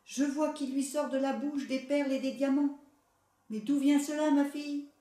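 eager mother, lightly voiced. A narrator's reading. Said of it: "dit sa mère".

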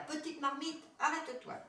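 high-pitched, urgent command; crying out. A narrator's reading. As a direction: call it out high to stop the pot. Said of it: "Florence lui cria".

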